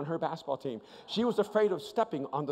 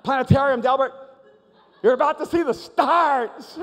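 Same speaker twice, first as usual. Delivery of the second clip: high, loud announcement over the ride noise; gleeful mock-reassuring holler; excited shouting; 8 semitones higher, 11 dB louder than usual. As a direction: yell it to the scared rider, stretching the end. Answering the panicked rider on the coaster.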